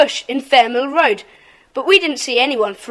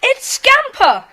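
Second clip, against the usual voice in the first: excited reveal; 10 semitones higher, slower than usual. Acted. Triumphant reveal of new member.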